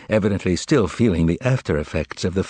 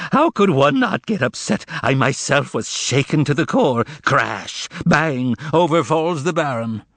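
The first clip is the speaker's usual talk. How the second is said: much higher, hammed-up lament; mock wailing; 8 semitones higher than usual. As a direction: moan it out like theatrical grief. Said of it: "Korovyov began wailing and moaning".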